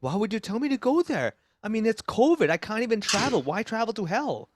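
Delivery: high-pitched, baffled comic protest; literal-minded protest. Acted. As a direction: object as if the insult were literal. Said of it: "in Chinese, you're like".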